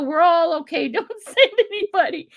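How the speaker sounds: high, loud, laughing reassurance; flustered laughing plea. Retold or imitated. Telling the 911 operator on the watch not to send anybody, high laughing plea. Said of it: "I'm like".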